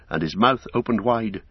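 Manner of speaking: plain reading voice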